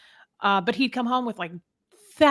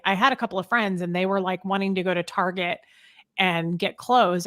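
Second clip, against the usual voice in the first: ordinary talk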